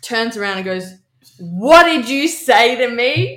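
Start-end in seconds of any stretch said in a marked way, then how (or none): 1.4-3.4 slow, loud, higher mock-tough confrontation; tough-girl challenge. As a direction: square up and drag out every word, picking a fight. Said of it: "big boots McGee me".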